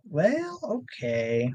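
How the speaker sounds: mock bewildered resignation; retold puzzled acceptance. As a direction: act out a baffled shrug. Retold baffled reaction to finding nothing.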